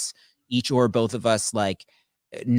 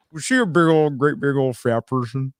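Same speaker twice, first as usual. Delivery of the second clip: higher, louder creepy movie-killer voice; Buffalo Bill; 6 semitones higher, 5 dB louder than usual. Acted. Quoting the film to co-host, Buffalo Bill voice. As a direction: do the film villain asking a leering question. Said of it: "I do that voice fairly well".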